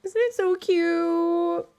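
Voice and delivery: high slow coo about a cute plush; gushing cutesy voice. Acted. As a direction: stretch it out, melting over the toy. Gushing over lemon plush.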